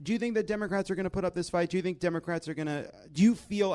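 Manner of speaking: usual speaking voice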